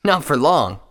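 high, loud cocky reply; grinning Scarlet. A narrator's reading. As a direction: toss it back through a grin. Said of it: "Scarlet replied with a grin".

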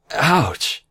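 high, sudden ouch; startled little cry of pain; pained yelp. Acted. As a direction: yelp at a sharp tug. She pulls her own mane sharply.

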